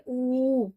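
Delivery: ऊ held long, a sustained oo; long uu vowel. Shown as a demonstration. Teacher holding the long vowel ऊ for the class. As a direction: stretch ऊ out for a full count. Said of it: "हम देर तक बोलेंगे".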